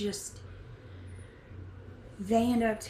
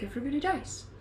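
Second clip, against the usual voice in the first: ordinary talk, nothing marked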